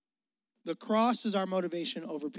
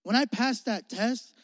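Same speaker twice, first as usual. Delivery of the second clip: ordinary talk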